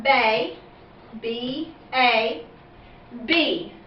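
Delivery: bay then letters B A named apart; spelling out. Shown as a demonstration. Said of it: "for the first part".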